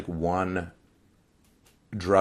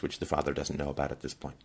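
ordinary talk, nothing marked

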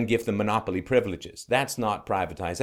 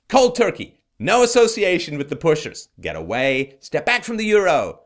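loud, raised emphatic orders; urgent command; 5 semitones higher, 8 dB louder than usual. Acted. Commentator ordering the addict away from the euro, urgent command. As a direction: warn an addict off forcefully.

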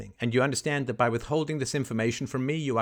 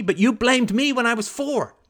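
ordinary delivery

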